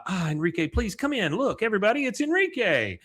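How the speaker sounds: high, bright hostess voice; high welcoming announcement; Peggy welcome. Retold or imitated. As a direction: greet everyone like a proud hostess.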